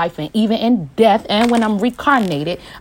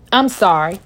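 ordinary delivery

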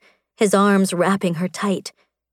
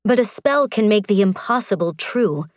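ordinary delivery, nothing marked

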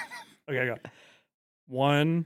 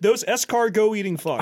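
mock angry rant; 8 semitones higher, 3 dB louder than usual. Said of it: "Matt said".

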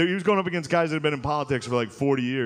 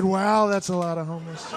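Trump impression; 5 semitones higher than usual. Shown as a demonstration. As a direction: describe it like Trump would. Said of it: "how to do his voice".

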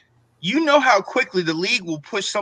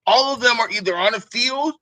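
ordinary delivery

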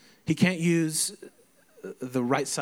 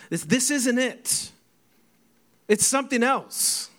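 worked-up protest; 10 semitones higher, 4 dB louder than usual. Retold or imitated. Retold protest to youth pastor.

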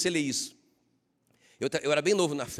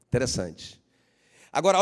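ordinary talk, nothing marked

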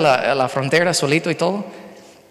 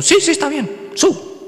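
much higher, quick mock driver voice; eager driver; 11 semitones higher, slower than usual. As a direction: squeak it out like a hurried driver. Second driver agreeing quickly.

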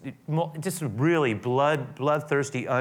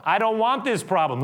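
louder, higher repeat of the complaint; exasperated Pilate; 9 semitones higher, 5 dB louder than usual. Acted. Pilate insisting he refuses the problem, higher still. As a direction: say it again with more force. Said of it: "is now going".

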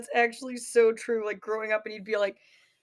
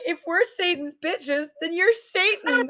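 high, slow, louder retort; mock cheeky comeback; 6 semitones higher, 5 dB louder, slower than usual. Girls answering mom back in the pool, mock cheekiness. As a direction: spell out the logic tauntingly.

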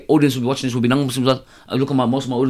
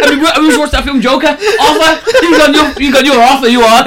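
laughing; 10 semitones higher, 9 dB louder than usual. Retold or imitated.

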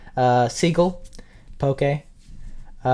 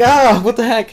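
startled; 8 semitones higher, 8 dB louder than usual. Retold or imitated. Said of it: "You wake up and you're like".

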